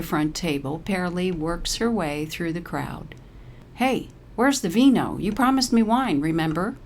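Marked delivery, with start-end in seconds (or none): 3.7-6.8 raised, higher insistent voice; demanding. A narrator's reading. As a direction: press her hard for the promised wine. Paralee demanding promised wine.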